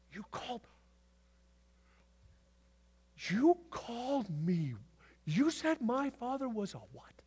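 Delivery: lightly voiced outraged Pharisee, high and drawn out; offended Pharisee. Pharisee reacting to being called the devil, indignant. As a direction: play the insulted Pharisee in disbelief. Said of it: "Can you imagine being a Pharisee".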